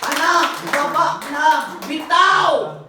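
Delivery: loud, high shout to let go. Retold or imitated. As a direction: yell at the child to come down. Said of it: "father shouting to child".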